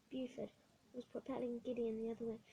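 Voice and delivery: usual speaking voice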